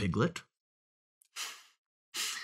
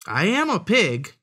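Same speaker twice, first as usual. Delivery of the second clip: higher-pitched storybook piglet; wheedling piglet; 10 semitones higher, 7 dB louder than usual. A narrator's reading. Piglet stalls the fox, wheedling. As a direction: make an innocent excuse.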